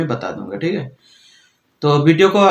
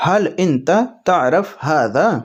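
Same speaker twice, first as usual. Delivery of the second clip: very slow, deliberate phrase; slow Arabic demo; 2 semitones higher, much slower than usual. Shown as a demonstration. Teacher modelling the Arabic question slowly for viewers. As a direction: lay out "حل انت تعرف ھذا" slowly for learners. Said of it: "کیا آپ کو یہ پتہ ہے".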